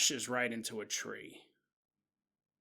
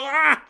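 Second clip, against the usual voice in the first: loud, high mock shriek; scared scream; 10 semitones higher, 10 dB louder than usual. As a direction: yelp like a horror fan jumping. Kenneth scared by The Crow.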